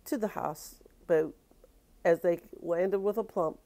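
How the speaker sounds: ordinary delivery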